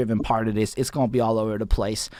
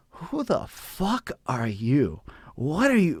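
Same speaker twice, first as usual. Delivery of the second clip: slow, drawn-out hostile challenge; 2 semitones higher, 4 dB quieter, slower than usual. Retold or imitated. Rapper confronting a stranger backstage, slow mock menace. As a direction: stretch it out like a suspicious gatekeeper. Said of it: "he just looks at me and goes".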